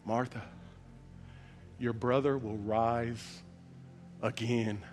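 slow, soft, steady reassurance; calm and peaceful. Acted. Jesus quietly comforts grieving Martha, calm and peaceful. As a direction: settle her gently. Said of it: "with calmness and peaceness".

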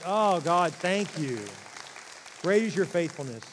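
loud, fervent thanks to God; grateful exclamation. Pretend. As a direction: burst out in relieved gratitude.

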